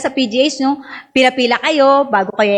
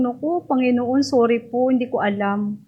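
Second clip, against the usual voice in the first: sheepish apology; 6 dB quieter than usual. Retold or imitated. Mother apologizes to scolding doctor.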